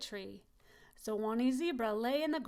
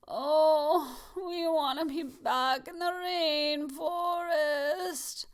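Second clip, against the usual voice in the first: slow, higher, drawn-out sick moan; 4 semitones higher, 5 dB louder, much slower than usual. Acted. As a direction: moan it as if ill.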